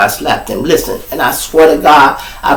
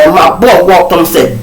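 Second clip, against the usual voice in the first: normal talking voice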